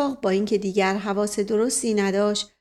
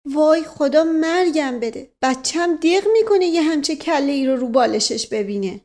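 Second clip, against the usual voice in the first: dismayed; 5 semitones higher, 5 dB louder than usual. Acted. Mother slaps face, laments.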